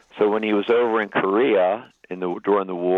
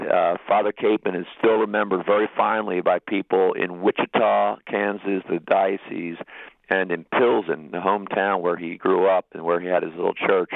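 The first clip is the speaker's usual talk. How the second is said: usual speaking voice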